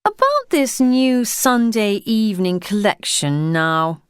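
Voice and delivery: put-on a posh English official addressing a postman, in a high public schoolboy accent; high-pitched public schoolboy accent. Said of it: "in his high-pitched public schoolboyish accent".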